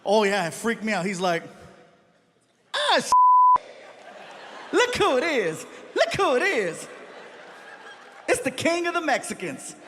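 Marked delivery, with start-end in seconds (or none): 2.7-9.8 high, excited greeting; a star delighted to spot a friend; Chris Rock impression.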